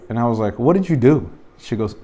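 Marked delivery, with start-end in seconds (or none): 0.6-1.3 surprised. Retold or imitated. Retold shock at the iPad.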